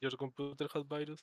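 soft, slow English in a put-on Indian accent; mock Indian accent. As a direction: imitate an Indian-accented English speaker, quietly. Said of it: "el inglés con el acento hindú".

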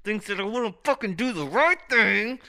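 furious rhetorical outburst; angry shout. Retold or imitated. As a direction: escalate into yelling the question. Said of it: "I'm like".

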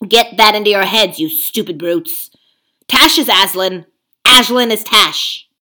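loud, pushy ape lecturing the beasts; a sly ape bullying the crowd; scheming ape. Acted. Ape haranguing the gathered beasts, loud and pushy.

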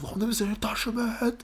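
high, squeaky put-on question; high-pitched mock indignation; scolding teacher. Imitates teacher challenging departing pupil.

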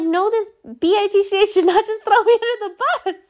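mock-outraged squeal at being thrown under the bus; outraged disbelief. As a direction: fire it off high and fast, half laughing in shock. Outraged retort, retold quote.

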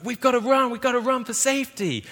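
high, loud hammed-up American accent. Townsfolk warned of a tornado, in a hammed-up American accent. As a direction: yell it like a panicked American in a film. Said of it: "This is an American accent, by the way".

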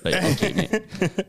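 high, laughing "yeah right" brush-off; laughing sarcasm. Retold or imitated. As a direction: humour the weirdo while laughing. Sarcastic reply to nutter's blessing.

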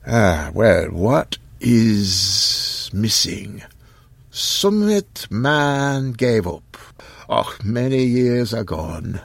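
put-on high, slow, halting old voice; an old man reluctant to tell; old man Occam.

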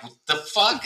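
a high mock outburst of disbelief; shocked what the fuck. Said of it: "you'll be like".

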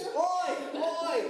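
a playful British "Oy!" shouted high. Pretend. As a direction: bark "Oy!" like a Londoner hailing a mate. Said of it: "Are you British?".

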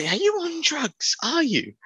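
high, slow disbelief; thinking he is clearly high; incredulous. Retold or imitated. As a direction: ask it in mock amazement. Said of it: "I'm thinking".

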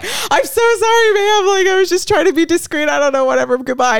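high, loud, rambling embarrassed apology; flustered mock apology. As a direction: blurt an exaggerated, panicky apology. Retells flustered apology after bumping woman.

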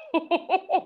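high-pitched chuckle after a punchline; real laugh. Real, not acted. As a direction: laugh at the joke.

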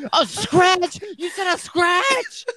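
very high, incredulous mock shriek; Kyle's mom. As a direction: screech it like an outraged cartoon mother. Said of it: "turned into Kyle's mom from South Park".

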